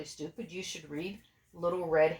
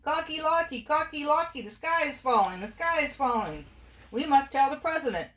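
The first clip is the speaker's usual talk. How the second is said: high, panicky bird voice; frantic yell; 8 semitones higher, 3 dB louder than usual. A narrator's reading. Birds warn Cocky Locky with a high yell. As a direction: cry out the alarm, high. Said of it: "and yelled".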